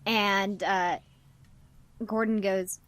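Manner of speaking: ordinary talk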